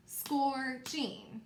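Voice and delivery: "Scorching" in two separated, stretched syllables; syllables clapped out. Shown as a demonstration. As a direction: clap out "Scorching" as scor, then ching. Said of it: "Let's do it one more time".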